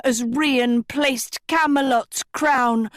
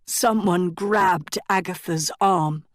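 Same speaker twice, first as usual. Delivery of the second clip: plain reading voice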